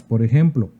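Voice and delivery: ordinary talk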